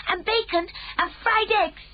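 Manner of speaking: put-on very high, eager child's voice; hungry little girl hoping. A narrator's reading. Sophie asks.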